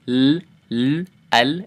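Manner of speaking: L sounds held and clear, tongue tip on the upper palate; slow, crisp letter demo; Arabic L demo. Shown as a demonstration. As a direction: demonstrate the Arabic L. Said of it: "So your tongue's gonna touch right there".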